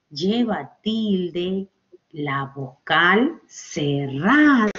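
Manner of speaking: very slow, word by word with pauses; slow emphatic rule. Shown as a demonstration. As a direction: stretch each word out for the class. Teacher slowly spells out the rule.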